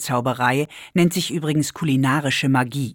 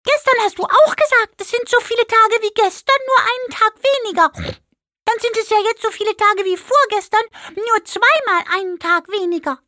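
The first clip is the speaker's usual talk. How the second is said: very high pig voice, working it out aloud; puzzled pig voice; 4 dB louder than usual. A narrator's reading. Pig argues back to Maike, very high and puzzled. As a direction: puzzle it through like a confused little pig. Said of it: "Das Schwein sah verwundert drein".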